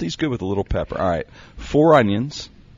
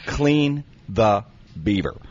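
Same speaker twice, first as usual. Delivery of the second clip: "Clean the beaver" slowly, with weight on "Clean"; emphatic, slow; slower than usual. Shown as a demonstration. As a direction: stress "Clean" and draw the phrase out. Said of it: "I'm gonna emphasize this".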